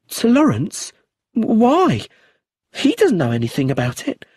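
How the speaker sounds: high, sharp alarm; alarmed widow. Acted. Widow protecting Lawrence from the inspector, high alarm. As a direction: snap up in worried surprise. Brenda defensive about Lawrence.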